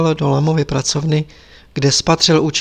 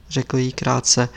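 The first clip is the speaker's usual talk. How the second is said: ordinary delivery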